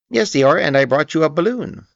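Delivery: high squeaky little voice; timid small pig, eager and high; Piglet. A narrator's reading. Piglet telling Eeyore about his balloon, squeaky.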